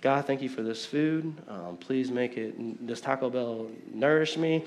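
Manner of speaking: mock rushed prayer. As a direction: rattle off a lazy grace before a meal. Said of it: "Some of us will pray".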